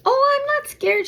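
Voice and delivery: very high, loud, laughing mimicry; carefree stranger. Stranger brushing off the dog warning, high mocking mimicry. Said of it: "She goes".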